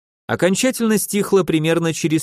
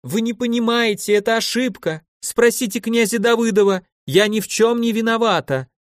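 pleading in panic; 8 semitones higher than usual. A narrator's reading. Lady pleading through the door.

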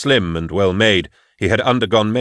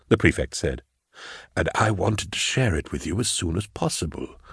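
smooth, quiet official relishing his announcement; unctuous prefect; 4 dB quieter than usual. Acted. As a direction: savour the news softly. Prefect announces his good news.

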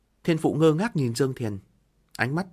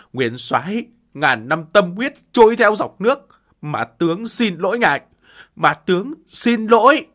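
higher, louder sobbing cry; sobbing apology; 6 semitones higher, 9 dB louder than usual. Acted. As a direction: sob the apology out. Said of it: "gào khóc".

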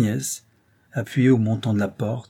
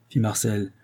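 plain reading voice

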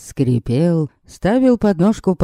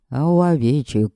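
ordinary delivery

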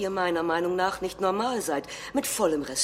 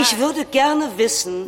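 raised voice pushing past the interruption; loud and higher, refusing to yield; shouting over; 6 semitones higher, 8 dB louder than usual. Acted. Salvini shouts down the chairwoman interrupting him, loud.

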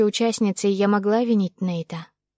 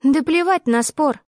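higher, slow furious outburst; boiling anger; 7 semitones higher, 4 dB louder, slower than usual. Acted. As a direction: spit it out seething. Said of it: "чувствуя, как закипаю от злости".

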